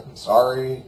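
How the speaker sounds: ordinary delivery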